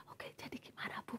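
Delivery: voiceless whisper with her voice gone. Retold or imitated. Aunt with a lost voice whispering to the caller. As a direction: breathe the words out with no voice at all. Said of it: "Terus ngomong pelan kayak gini".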